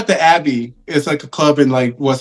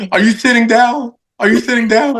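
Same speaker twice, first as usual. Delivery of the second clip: dramatic build-up; 9 semitones higher than usual. Retold or imitated. Retold dramatic call to mom.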